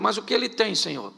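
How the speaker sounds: ordinary talk, nothing marked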